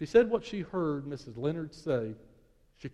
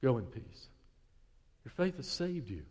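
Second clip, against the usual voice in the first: God's gentle voice; 3 semitones lower than usual. Pretend. God to the believer before the crowd, slow and tender. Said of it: "And He says for the whole crowd to hear".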